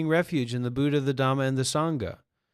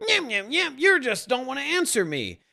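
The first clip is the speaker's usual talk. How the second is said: much higher, petulant mock whine; mocking whiny voice; 9 semitones higher than usual. Mocking the would-be sage in the kitchen, high whine. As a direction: whine it up high like a sulky child.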